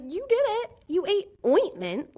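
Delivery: high-pitched mock scolding of a pet; high, drawn-out mock outrage; mock accusing. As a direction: accuse the dog in a high, exasperated voice. Mock scolding the dog in retelling.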